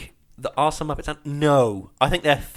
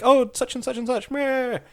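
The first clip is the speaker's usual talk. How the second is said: lightly voiced puppet host, higher pitch; Kermit; 7 semitones higher than usual. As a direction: toss off a sketchy puppet-host voice. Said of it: "having Kermit come on and be like".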